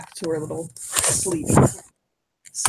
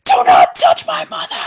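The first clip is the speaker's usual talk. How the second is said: shrieked high and loud, far above her own voice; furious scream; 6 dB louder than usual. Acted. Goddess Freya screaming at the man who touched her mother.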